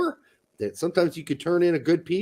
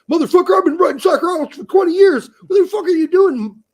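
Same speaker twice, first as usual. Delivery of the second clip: retold outraged yell, much higher and louder, fast; furious rant; 8 dB louder, faster than usual. Writer retells raging at editor who cut his piece, mock furious yell. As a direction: blast the editor in a high, fast fury. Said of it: "I was all pissed off".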